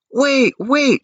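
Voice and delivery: high, loud cry of wait; sharp high shout. A narrator's reading. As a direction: call out urgently to stop them. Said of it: "shouted Antonia".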